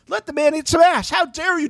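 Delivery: high, insistent scolding; emphatic defense. Real, not acted. Defending cast member emphatically.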